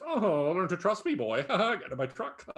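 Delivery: creepy man luring a boy into his truck, mock voice; mock creepy mustache man. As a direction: ham up a shady guy offering a ride. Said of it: "a mustachioed man that says".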